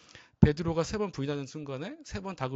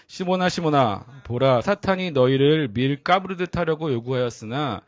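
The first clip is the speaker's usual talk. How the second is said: solemn scripture reading; 4 semitones lower, 4 dB louder than usual. Acted. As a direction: proclaim the words to the congregation. Reads scripture aloud. (회중과 함께 성경을 엄숙히 낭독)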